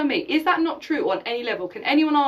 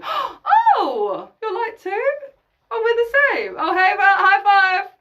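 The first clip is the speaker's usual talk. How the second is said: much higher and louder, gushing with delight; squealing, over-the-top excited hello; mock thrilled greeting; 9 semitones higher, 7 dB louder, slower than usual. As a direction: play it like bumping into a long-lost friend. Acted joyful meeting of fellow light.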